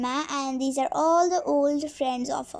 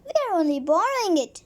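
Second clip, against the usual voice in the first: plain reading voice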